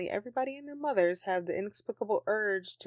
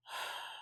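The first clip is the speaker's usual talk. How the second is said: tired sigh; 11 dB quieter than usual. Acted. Sulky sigh.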